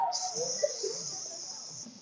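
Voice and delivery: long soft snake hiss. Acted. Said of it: "And Snake went".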